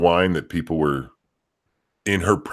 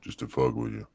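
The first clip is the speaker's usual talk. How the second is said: talking even deeper; 7 semitones lower, 9 dB quieter than usual. Pretend. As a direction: push the voice lower. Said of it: "I'm gonna talk even deeper".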